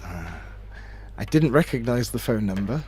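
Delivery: usual speaking voice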